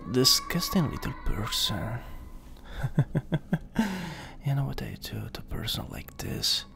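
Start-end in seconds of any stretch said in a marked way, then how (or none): 2.0-4.4 evil chuckle. Acted. Demon laughing at victim.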